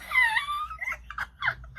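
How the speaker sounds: breaks into a high, helpless laugh; real laughter, high-pitched; high cackling laugh. Real, not acted. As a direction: let a squealing laugh burst out. Laughing at retold call.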